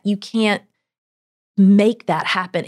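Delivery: ordinary delivery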